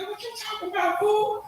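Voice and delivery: high mock woman's voice, indignant; girlfriend snapping back. Comedian voicing girlfriend brushing off his accusation, high indignant voice.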